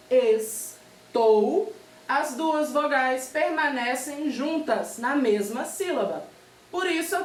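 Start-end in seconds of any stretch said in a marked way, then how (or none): 0.1-1.7 "estou" in two separate beats, es, tou; syllable by syllable. Shown as a demonstration. Teacher separating a word by syllable, "estou" in two beats. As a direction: pause between es and tou in "estou". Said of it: "Quando eu vou separar esta palavra por sílaba".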